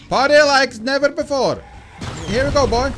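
high, loud party shout; loud, exuberant cheer; excited hype. Real, not acted. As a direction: whoop it to rally your buddy. Hyping the beast up.

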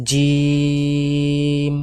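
জিম held very long, thin mouth; long drawn letter. Shown as a demonstration. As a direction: stretch জিম for three seconds. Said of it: "আমার সঙ্গে সঙ্গে আপনারাও বলবেন".

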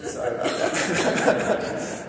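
laughing through the words, mostly breath; real embarrassed laughter. Real, not acted. Priest laughing at his two million words, embarrassed. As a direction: giggle it out, a bit sheepish.

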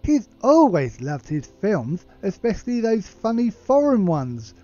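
slow, put-on teacher voice rising high; old teacher. Retired history teacher praising a former pupil, put-on voice. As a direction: play a doting retired teacher, drawn out.

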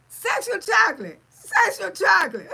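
loud, high, laughing through the words; laughing shout. Real, not acted. Cracking up at a viewer's Eddie Murphy joke, loud laughing shout.

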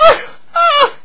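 very high, sobbing wail; long piercing cry of grief; anguished wail. Acted. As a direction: let out a high-pitched sob. Breaks down after the plea.

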